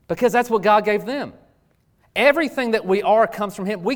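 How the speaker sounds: preaching. Acted. Pastor drives the point home to the church, preaching hard. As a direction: preach it out to the congregation with fire.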